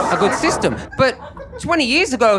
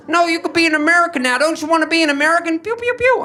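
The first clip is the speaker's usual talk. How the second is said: pushy excited American; 11 semitones higher, faster than usual. Retold or imitated. Americans urge him to become American, fast excited high voice. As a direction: hype him up, then fire finger guns.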